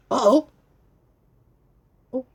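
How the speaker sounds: high loud uh-oh; 1 alarmed uh-oh. Acted. Reader to child, loud alarmed uh-oh. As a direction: sound the alarm playfully.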